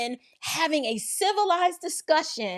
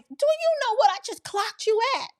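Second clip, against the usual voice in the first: high-pitched mock officer challenge; stern officer; 9 semitones higher than usual. Officer to speeding driver at the window, stern demand.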